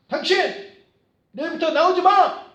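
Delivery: shouted high and loud, firing someone on the spot; a furious boss barking at an employee; angry boss. Acted. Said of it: "이렇게 하면 안 되겠죠". (부하 직원에게 화내며 소리치는 상사)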